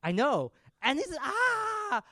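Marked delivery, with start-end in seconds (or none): 1.2-2.0 high, loud mock scream. Victim as his finger is cut off, mock scream. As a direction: let out an acted cry of pain. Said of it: "the women are screaming".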